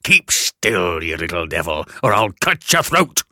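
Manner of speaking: low, loud convict threat; menacing convict. Acted. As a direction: snarl it like an escaped convict.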